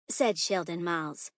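usual speaking voice

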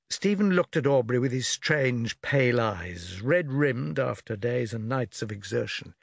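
ordinary delivery, nothing marked

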